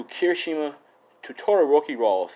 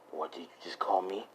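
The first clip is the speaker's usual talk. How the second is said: quiet menace; 5 semitones lower, 11 dB quieter than usual. Acted. Menacing question after insult overheard.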